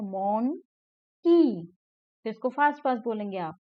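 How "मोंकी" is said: मोंकी drawn out as mon, pause, kee; broken into syllables. Shown as a demonstration. Teacher showing how monkey is broken up, syllable-by-syllable मोंकी. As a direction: say मोंकी in two separated beats. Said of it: "मंकी को ऐसे बोलते हैं".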